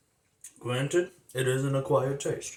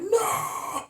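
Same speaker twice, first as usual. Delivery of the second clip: very high cartoon-detective yelp; a sudden squeaky cartoon cry; Inspector Gadget voice. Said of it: "why I'm doing Inspector Gadget".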